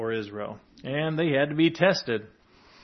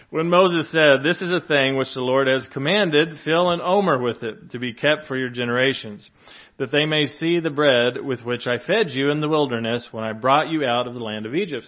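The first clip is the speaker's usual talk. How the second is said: scripture reading; 6 dB louder than usual. Acted. Reads scripture passage aloud.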